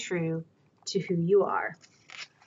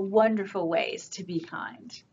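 whisper; 6 dB quieter than usual. Real, not acted. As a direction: share it softly like a secret. Reader ends with soft remark.

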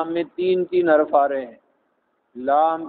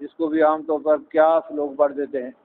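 usual speaking voice

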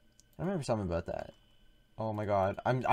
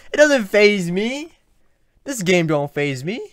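louder and higher bravado; mock tough; 4 semitones higher, 7 dB louder than usual. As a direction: puff it up like a tough guy.

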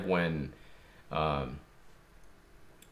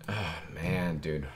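usual speaking voice

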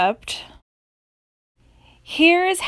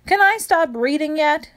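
usual speaking voice

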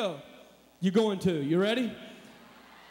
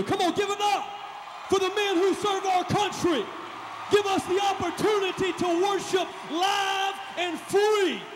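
rallying shout; 9 semitones higher than usual. Real, not acted. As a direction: lift the room for the soldiers, full voice. Hyping crowd to honor troops.

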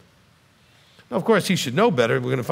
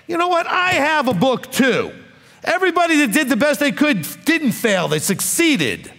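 preaching at full force; 9 semitones higher than usual. Acted.

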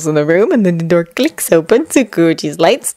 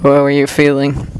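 flat; 4 semitones lower than usual. Acted. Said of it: "voice flat".

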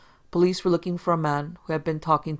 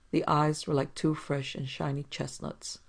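plain reading voice

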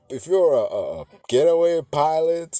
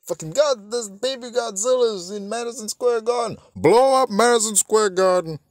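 high, mock-frantic alarm; panic, then bellow the command; hammed-up shouting official; 11 semitones higher, 6 dB louder than usual. Acts out panicking movie government.

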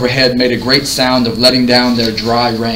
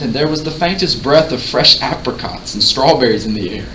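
ordinary delivery, nothing marked

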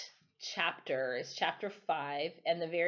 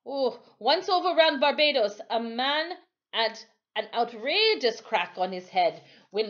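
louder island storyteller voice; Timothy voiced; 5 semitones higher, 6 dB louder than usual. Timothy comforts the boy with a tale, louder. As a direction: tell the old tale to comfort.

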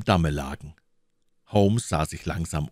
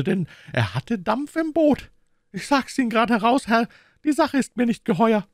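high, grumbling woman's character voice; distrustful boatman's wife; 4 dB louder than usual. A narrator's reading. Wife grumbles about her husband's suspect visitor, high distrustful voice. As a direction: complain in a much higher voice.

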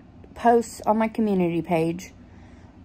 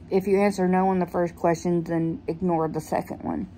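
ordinary talk

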